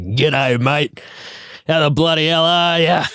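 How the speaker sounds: lightly hammed-up Aussie accent; put-on Australian accent. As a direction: greet like a stock Australian charmer. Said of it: "Do you put on the Australian accent?".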